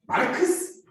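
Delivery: usual speaking voice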